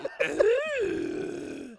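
loud, high-pitched cackle; can't hold the laugh in; high burst of laughter. Real, not acted. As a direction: laughs at her own gremlin comparison. Loud laugh after gremlin joke.

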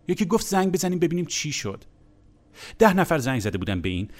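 ordinary talk

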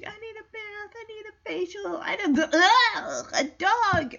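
high, whiny pampered cat; lightly voiced fussy cat, laughing; prissy cat voice. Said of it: "And she".

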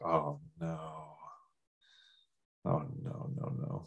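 very soft, sinking "oh no"; low, hushed dread, played for comedy; quiet dismay. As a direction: fade away in hushed dismay. His reaction to couples marrying.

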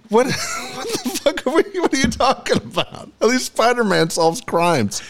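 cracked up, half-asking through laughs; laughing disbelief. Real, not acted. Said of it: "dumbest thing I've ever heard".

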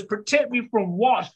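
high, incredulous comeback; retold indignant outburst; mock yelling mum. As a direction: retort like an outraged mother. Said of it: "By this time I'm yelling".

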